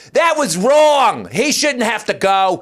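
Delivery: high, loud, drawn-out protest; mock indignant woman's holler; heckling lady. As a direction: wail it from the back of the room. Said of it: "a lady in the back goes".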